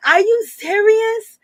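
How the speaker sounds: high, drawn-out disbelief; incredulous. Retold or imitated. Vlogger reacting to the rapper's boasts, high incredulous question.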